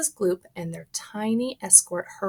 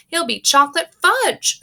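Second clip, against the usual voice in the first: high, loud shriek; 10 semitones higher, 8 dB louder than usual. Acted. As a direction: shriek it in panic. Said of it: "shrieked Missus Gloop".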